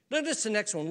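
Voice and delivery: ordinary delivery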